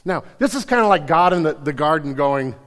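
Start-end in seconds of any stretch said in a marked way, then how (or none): none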